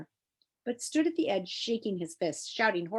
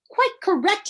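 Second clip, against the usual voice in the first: much higher and louder gloating shriek; triumphant shriek; 13 semitones higher, 8 dB louder than usual. Acted. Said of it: "he shrieked triumphantly".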